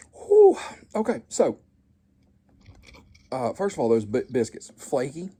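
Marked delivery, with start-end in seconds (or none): 0.1-0.6 loud relieved whew. Real, not acted.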